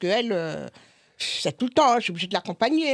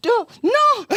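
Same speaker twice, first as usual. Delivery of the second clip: panicked begging; 10 semitones higher, 5 dB louder, slower than usual. Retold or imitated. Begging the nurse to stop.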